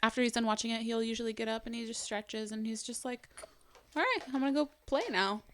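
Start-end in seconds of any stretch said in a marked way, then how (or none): 4.0-5.4 higher, easygoing child imitation; lightly voiced child. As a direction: lightly imitate a contented kid. Child voiced calmly leaving show.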